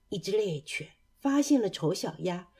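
ordinary delivery

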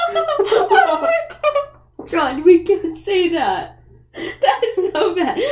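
high, loud, drawn-out laughing protest; real scandalised laughter, stretched out; laughing shock. Real, not acted. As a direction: scold a friend while cracking up. Shocked laughter at dark joke.

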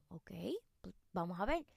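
hushed, breathy aside; quiet resigned reply; whispered reply. Retold or imitated. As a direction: mutter it under your breath.

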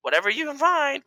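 high, sing-song imitation of a brush-off; mock dismissive partner. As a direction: parody her curt reply. Mimics partner's dismissive answer.

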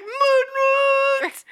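high loud cry of pain, cut off. Retold or imitated. Actor crying out at the table read, loud mock yelp.

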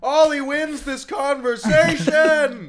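booming, slow victory cry; loud announcement. Pretend. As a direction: announce the winner at full volume.

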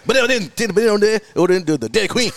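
run-together Cajun gibberish, clear last words; mock Cajun accent. Cajun local giving landmarks to a lost visitor, garbled Cajun accent. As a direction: garble all but the ending, Cajun style. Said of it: "all you understand is the last word".

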